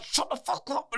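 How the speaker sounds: high-pitched disbelief, not shouted; retold squeak of amazement; incredulous outburst. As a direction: react in high, stunned disbelief. Retold shock at psychic's claim.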